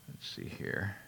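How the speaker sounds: whispered aside while looking something up; muttering to himself. Real, not acted. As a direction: breathe it under your breath, half to yourself.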